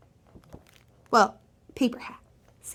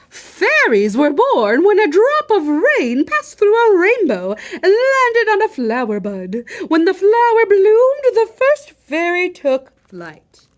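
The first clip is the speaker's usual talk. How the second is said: high, loud storybook teacher; lofty lecturing fairy; 7 semitones higher, 6 dB louder than usual. Acted. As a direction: proclaim it like a grand fairy teacher.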